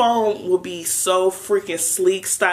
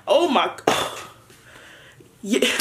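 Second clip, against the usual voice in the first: normal talking voice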